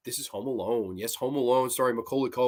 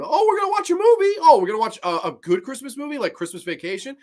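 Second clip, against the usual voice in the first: mock school teacher; 10 semitones higher, 5 dB louder, faster than usual. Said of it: "they would show it in the auditorium".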